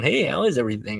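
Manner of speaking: hammed-up cheery table check; chirpy manager greeting. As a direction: imitate a manager working the room. Manager doing his rounds.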